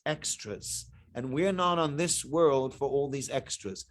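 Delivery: mock pious voice. Speaker mocks a man's showy modesty, hushed and pious. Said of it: "and I'm like".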